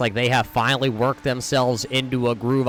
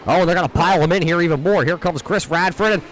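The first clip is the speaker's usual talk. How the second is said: rising excited race call, louder and higher; motorsport commentator; 4 semitones higher, 3 dB louder than usual. Real, not acted. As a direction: call the wreck as it happens, voice climbing. Crash unfolds during race call.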